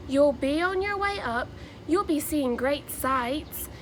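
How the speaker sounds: lively, sing-song picture-book read-aloud; animated Dr. Seuss storytelling with rhyming bounce; reading to children. Acted. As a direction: read it like story time for a class of kids.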